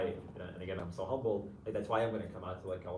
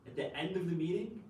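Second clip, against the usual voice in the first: ordinary talk, nothing marked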